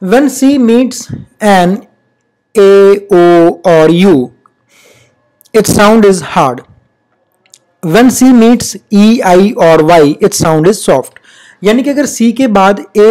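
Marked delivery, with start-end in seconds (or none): 0.0-11.2 slow rule reading. Shown as a demonstration. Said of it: "reading the rule slowly".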